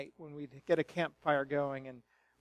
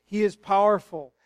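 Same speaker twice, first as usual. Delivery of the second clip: usual speaking voice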